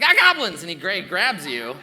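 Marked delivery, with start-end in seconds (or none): 0.0-0.6 loud, high startled cry; panicked yelp. Acted. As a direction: yell in sudden panic. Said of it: "He's like".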